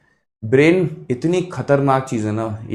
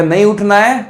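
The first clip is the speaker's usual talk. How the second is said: raised, louder refusal; refusing brain; 7 semitones higher, 4 dB louder than usual. Acted. Brain refusing to get up in the morning, raised stubborn refusal. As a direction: play the brain digging in, pitched up. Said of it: "दिमाग कहेगा".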